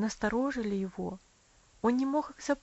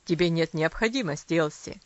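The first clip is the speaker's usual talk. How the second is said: serious; 5 semitones lower, 6 dB louder than usual. A narrator's reading. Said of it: "ответил он серьёзно".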